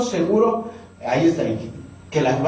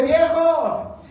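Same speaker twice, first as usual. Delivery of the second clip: wife calling out; 10 semitones higher than usual. Retold or imitated. Wife calls husband from kitchen.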